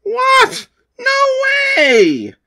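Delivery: very high shocked yell; shocked shout. Acted. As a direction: shriek in disbelief at losing. Rival loses battle.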